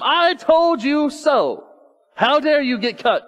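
slow, high and loud scolding of his own arm; mock-yell at the injured arm. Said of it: "If I yell at my arm".

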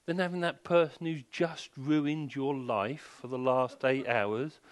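ordinary talk

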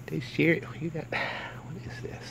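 slow hushed whisper, breathy sigh first. Real, not acted. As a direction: whisper it while peering closely at the eye. Examining gunk in dog's eye.

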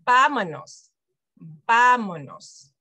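"vámonos" with a loud, stressed VÁ; VÁ-monos, opening syllable hit hard; stress on first syllable. Shown as a demonstration. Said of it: "where you're gonna put the emphasis".

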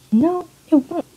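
high, loud refusal; indignant protest. Acted. Noelle refuses a punch in the throat, indignant protest. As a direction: protest sharply with pitch leaping up.

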